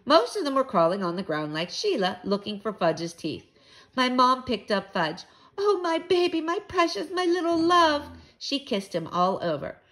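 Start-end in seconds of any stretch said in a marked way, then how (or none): 5.6-8.1 high-pitched, gushing fuss; doting mother. A narrator's reading. Mother picking up her hurt little boy, fussing dotingly. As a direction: coo over a hurt baby.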